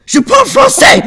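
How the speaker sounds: French yelled in a mock Japanese accent; high, very loud anime-style Japanese accent; shouted Japanese accent. Shown as a demonstration. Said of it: "in a Japanese accent then".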